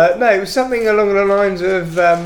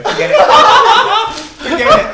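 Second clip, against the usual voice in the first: high, loud helpless laughter; laughing; 7 dB louder than usual. Real, not acted. Giving up the impression, helpless high laughter. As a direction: crack up and squeal it out.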